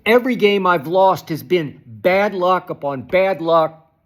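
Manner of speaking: ordinary talk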